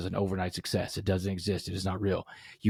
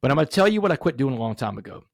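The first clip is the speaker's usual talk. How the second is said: ordinary talk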